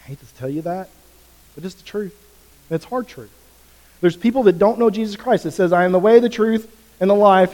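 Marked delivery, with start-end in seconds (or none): 4.0-7.5 higher and louder, driving the scripture home; preaching at full force. Acted. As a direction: proclaim it with fire. Preaching hard truth.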